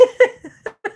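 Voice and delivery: high-pitched real laugh. Real, not acted. Streamer laughing after his jibe at a troll, high laugh.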